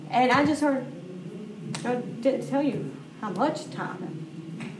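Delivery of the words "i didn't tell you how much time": soft and slow, a patient loving answer; gentle divine reply. Retold or imitated. Holy Spirit gently corrects a busy mother, soft and slow. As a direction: speak it softly and slowly, with patient love.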